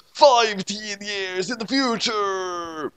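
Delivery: high, slow dramatic proclamation; dramatic announcer. A narrator's reading. Repeating the vision for drama, slow high proclamation. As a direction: stretch it out like a movie trailer.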